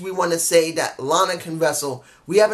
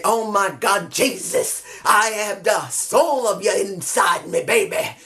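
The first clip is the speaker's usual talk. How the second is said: a hint of a gospel preacher, swooning; breathy mock revival swoon, mostly in own voice; mock church preacher. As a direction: ham it up like he's at church, lightly. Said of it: "He sounded like he went to church".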